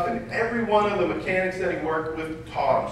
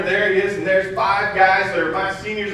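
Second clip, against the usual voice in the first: ordinary delivery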